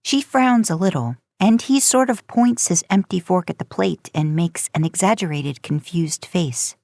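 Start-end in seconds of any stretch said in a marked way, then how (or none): none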